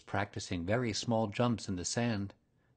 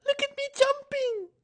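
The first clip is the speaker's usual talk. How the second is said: very high, squeaky little joey; squeaky Roo; 5 dB louder than usual. A narrator's reading. Baby Roo shows Pooh his jumping, squeaky and very high. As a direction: squeak it like a tiny proud baby kangaroo. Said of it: "squeaked Roo".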